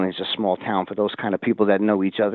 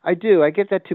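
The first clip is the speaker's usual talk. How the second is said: raised pitch and louder, eager yes; excited agreement; 8 semitones higher, 5 dB louder than usual. Real, not acted. Host eagerly agrees with his guest, raised and louder.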